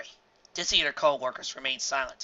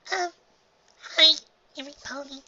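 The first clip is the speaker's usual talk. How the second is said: timid filly; 13 semitones higher, 5 dB louder than usual. A narrator's reading.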